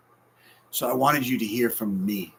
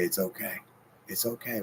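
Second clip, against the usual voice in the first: calm yourself gently; reassuring; 8 dB quieter than usual. Pretend. Soothing self-talk.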